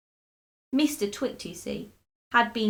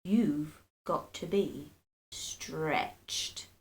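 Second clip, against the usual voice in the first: low, very slow menace; sinister low; 5 semitones lower, 3 dB quieter, much slower than usual. A narrator's reading. Cruel husband lowers his voice, very slowly. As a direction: drop low and draw it out slyly.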